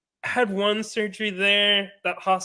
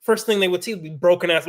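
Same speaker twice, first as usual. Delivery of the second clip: usual speaking voice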